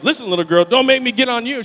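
loud mock warning to a child; loud retold confrontation; acted threat. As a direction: imitate a woman squaring up for a fight. Woman threatens girl, acted quarrel.

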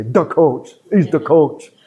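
punchy Chicago accent, THE as duh; mock a Chicagoan saying THE COACH; exaggerated Chicago local voice. Shown as a demonstration. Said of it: "they say".

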